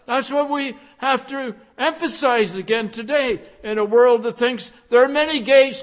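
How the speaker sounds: usual speaking voice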